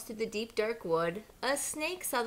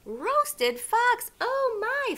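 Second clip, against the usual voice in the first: high, loud shocked squeal; alarmed fox; 13 semitones higher, 8 dB louder, much slower than usual. A narrator's reading. Fox hearing he is the Gruffalo's food, shocked high squeal. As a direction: fox jolts up in fright. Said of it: "Fox said".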